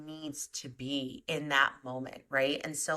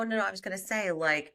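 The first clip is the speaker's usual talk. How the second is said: ordinary talk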